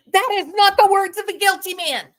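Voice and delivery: loud, high-pitched exclamation; incredulous outburst; emphatic disbelief. Real, not acted. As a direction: protest it loudly, pitched right up. Exclaims in disbelief at son's words.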